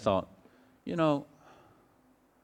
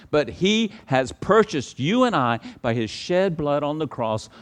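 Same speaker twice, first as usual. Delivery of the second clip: preaching at full force; 7 semitones higher, 9 dB louder than usual. Acted. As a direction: proclaim it to the whole church.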